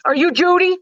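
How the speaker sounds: high, eager voice of the nervous dad; nervous dad asking for Judy, high and eager; dad's voice. Retold or imitated. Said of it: "And he said".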